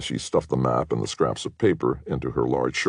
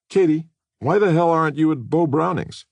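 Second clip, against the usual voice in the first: much higher and louder, demanding; a boyfriend confronting Katie about her guests; angry demand; 14 semitones higher, 5 dB louder than usual. Acted. Rick angrily confronting Katie.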